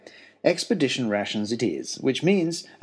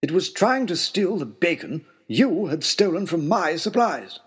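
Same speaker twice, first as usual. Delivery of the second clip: a lightly voiced, higher indignant lord; Lord Daunt's voice; 4 semitones higher than usual. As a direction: correct him with wounded pride. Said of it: "pointed out Lord Daunt".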